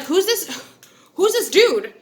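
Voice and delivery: high, mock-jealous demand; jealous boyfriend. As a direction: mimic a suspicious boyfriend asking who this is. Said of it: "Kingsley's just looking at me like".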